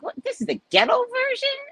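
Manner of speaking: shocked disbelief. Retold or imitated. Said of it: "And I was like".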